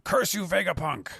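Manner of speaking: high-pitched furious villain cry; angry curse. Acted. Bird villain cursing his enemy, high angry shout. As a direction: spit the curse out at the rival. Mars blasts back cursing.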